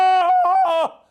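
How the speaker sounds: high, very loud jungle yodel; full-throated Tarzan cry; Tarzan yell. Shown as a demonstration. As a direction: let out a long warbling ape-man holler. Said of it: "And I do Tarzan".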